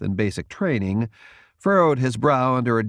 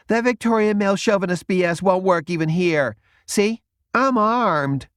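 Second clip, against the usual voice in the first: defiant retort; 10 semitones higher, 4 dB louder than usual. A narrator's reading. Wiz defiantly protests.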